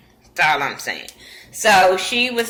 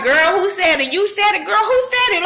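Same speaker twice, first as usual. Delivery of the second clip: put-on higher, eager girl who said it; mock excited gossip; 11 semitones higher than usual. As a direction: egg them on like nosy friends. Whole table egging on gossip.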